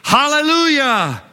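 high ringing hallelujah shout; preacher shouting praise for the church to echo. Shown as a demonstration. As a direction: call it out to lead the crowd. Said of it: "say it".

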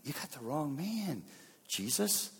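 soft, muted objection; internal protest. Acted. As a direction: object quietly, as a private thought. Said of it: "don't we do that internally?".

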